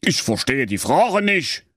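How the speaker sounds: high, huffy royal bluster; blustering king. A narrator's reading. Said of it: "polterte König Hennerkotelett ungehalten".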